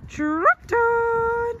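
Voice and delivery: high, drawn-out "Trot on" call to the horse; lilting rider's call; sing-song trot command. Real, not acted. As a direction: stretch it high so the horse hears it.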